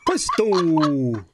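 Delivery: high hammy cartoon Russian; cartoon villain playing magician; villain Russian accent. Zaslav as Bullwinkle.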